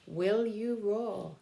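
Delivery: earnest storybook poem reading, slowing at the end; reading a poem aloud. Acted. Teacher reading the book's closing poem to her class, earnest storybook voice. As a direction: plead with the children through the rhyme.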